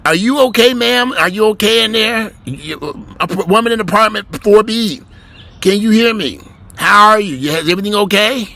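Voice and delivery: loud, high call from down at the foot of the door; yelling up at the door. Pretend. Officer hollers up to the woman in apartment four B, loud and high. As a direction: holler up, keeping your distance. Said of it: "stand down at the foot of the door and just yell".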